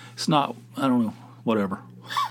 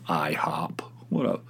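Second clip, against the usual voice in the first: conspiratorial whisper; whispered aside; 6 semitones lower, 6 dB quieter, slower than usual. Acted. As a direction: breathe it like a secret. Mock whisper aside about IHOP.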